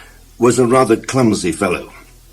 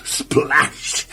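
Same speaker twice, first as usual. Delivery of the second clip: ordinary delivery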